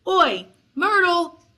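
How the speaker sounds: loud, high, drawn-out call; bellow. A narrator's reading. Poltergeist summoning a ghost girl, bellowing. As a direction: bellow the name across a room. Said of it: "took a deep breath and bellowed".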